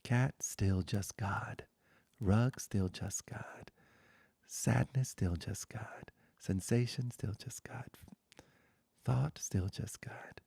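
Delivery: slow, hushed pointing-out litany, partly whispered; a spiritual teacher's slow, half-whispered refrain; contemplative litany. Acted. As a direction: let each word land in stillness. Meditative litany over objects.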